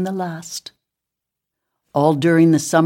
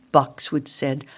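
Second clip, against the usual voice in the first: ordinary delivery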